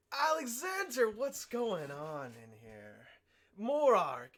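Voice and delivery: booming shout across the room; calls out loud as he walks in. Acted. Norris bursts in and shouts at Alexander, booming.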